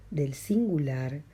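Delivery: ordinary delivery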